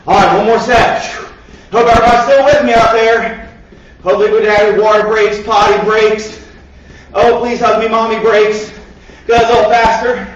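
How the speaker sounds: normal talking voice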